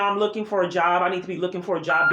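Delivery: usual speaking voice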